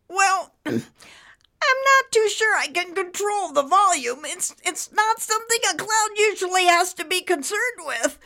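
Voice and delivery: high squeezed cartoon voice; nervous high-pitched cloud under pressure; strained, worried cloud. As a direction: fret while barely holding it in.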